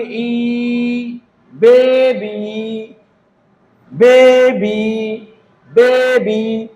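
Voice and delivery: बेबी very slow, with a long final "eee". Shown as a demonstration. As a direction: hold ई, then stretch both syllables of बेबी. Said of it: "तो उसको क्या पढ़ेंगे?".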